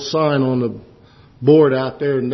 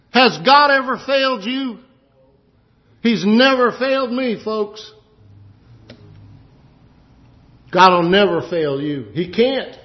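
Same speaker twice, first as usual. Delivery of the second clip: high, loud preacher's call; fervent preaching; 9 semitones higher, 4 dB louder than usual. Acted. Preacher urging the congregation not to fear, rising fervor. Preacher builds to full force.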